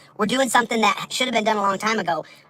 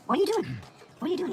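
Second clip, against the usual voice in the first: high breathy teasing question; playful high teasing; 6 semitones higher, 7 dB quieter, faster than usual. Acted. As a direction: tease like talking to a pet.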